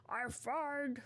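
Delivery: lightly voiced monster, slow start; a hint of Venom. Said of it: "Venom's more like".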